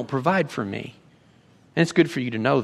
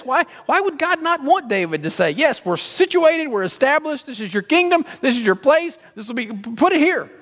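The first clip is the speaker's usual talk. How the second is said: much higher, baffled questioning; puzzled Bible student; 14 semitones higher, 4 dB louder than usual. Retold or imitated. A Bible student puzzling over the text, baffled and high. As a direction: ask it like a student who can't make sense of it. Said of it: "any serious Bible student would come to this and go".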